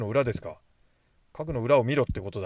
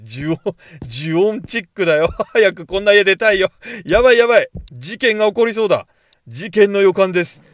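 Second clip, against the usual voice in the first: loud, laughing, keyed-up chatter; half-laughing nervous thrill, louder; excited scared rambling; 6 semitones higher, 11 dB louder than usual. Real, not acted. Player spooked in the haunted house, loud nervous excitement.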